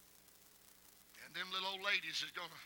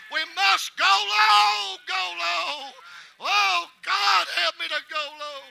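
building, impassioned preaching; 7 semitones higher, 12 dB louder, slower than usual. Real, not acted. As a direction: start raised and climb to a loud, high preacher's cry. Sermon climbs to its climax.

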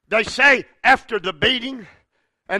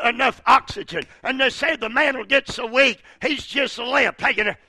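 ordinary delivery

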